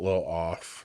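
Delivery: congested low voice; stuffy voice. Real, not acted. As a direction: sound stuffed up and off. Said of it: "excuse my voice".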